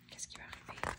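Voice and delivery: breathy suspense whisper; hushed, secretive storyteller. A narrator's reading. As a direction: lean in and whisper the question.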